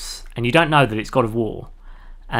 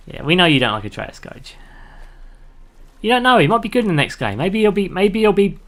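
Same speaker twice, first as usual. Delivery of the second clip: ordinary talk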